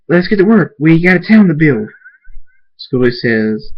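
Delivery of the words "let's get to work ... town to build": high, loud call to action; leader's loud pep shout; rallying call. Acted. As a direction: rouse the whole crowd. Thunderstorm rallies everyone to build.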